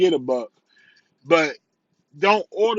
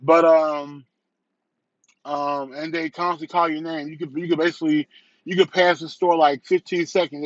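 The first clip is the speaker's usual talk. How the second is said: ordinary delivery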